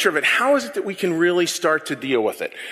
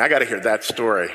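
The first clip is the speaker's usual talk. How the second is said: ordinary talk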